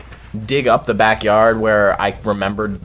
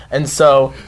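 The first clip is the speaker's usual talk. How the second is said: ordinary delivery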